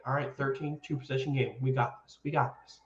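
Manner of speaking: understated and hushed; low-key coach imitation. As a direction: imitate a coach talking softly to himself. Quoting coach's calm sideline audio.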